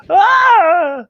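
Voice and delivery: high, loud playful scream; mock scream. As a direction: shriek as if the monster jumped out. Scary closet moment.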